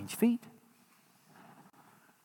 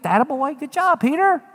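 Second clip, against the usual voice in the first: Peter praising himself; 7 semitones higher than usual. Retold or imitated. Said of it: "Peter's like".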